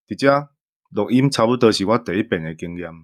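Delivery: ordinary talk, nothing marked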